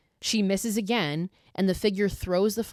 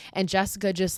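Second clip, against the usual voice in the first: ordinary delivery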